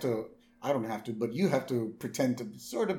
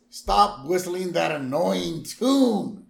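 Marge voice; 6 semitones higher, 8 dB louder, slower than usual. Retold or imitated. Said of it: "And Marge says".

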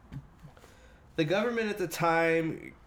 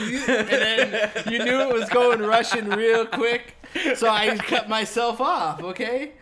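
laughing; 6 semitones higher, 8 dB louder than usual. Real, not acted. Defends himself while laughing.

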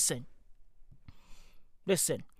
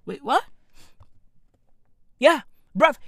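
ordinary talk, nothing marked

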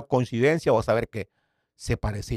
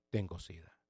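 stunned hush; 6 semitones lower, 17 dB quieter than usual. Retold or imitated. Stunned at the bad news.

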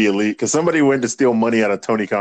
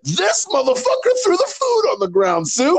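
high, laughing put-on voice; hammed-up high-pitched wrestler rant; high mock rant. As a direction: squeak out an angry rant. Retold Brody Lee rant.

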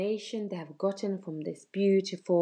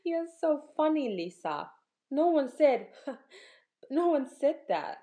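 laughing; 8 semitones higher than usual. Acted. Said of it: "Mika started laughing".

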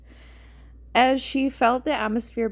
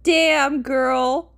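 loud, higher, drawn-out catcall; loud exclamation; 3 semitones higher, 6 dB louder than usual. A narrator's reading. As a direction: belt it out jokingly, ear-splitting. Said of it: "I'm so sorry for your ears".

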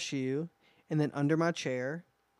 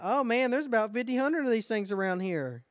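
careless staff; 6 semitones higher than usual. Pretend.

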